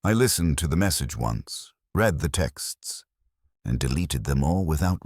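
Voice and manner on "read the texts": plain reading voice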